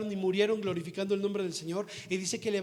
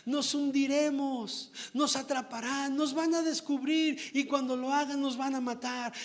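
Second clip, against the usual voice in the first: higher, slowed, fearful plea, lightly acted; frightened weeping woman; 6 semitones higher than usual. Runaway slave woman fearing capture in the boat, lightly acted fear. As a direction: voice a slave woman's mild mock panic. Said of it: "Y lloraba".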